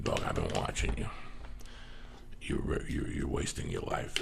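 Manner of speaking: put-on low and quiet, like a stern old cowboy giving advice; quoting a cowboy salesman, low and hushed; gruff cowboy mentor. Old cowboy salesman voiced in story.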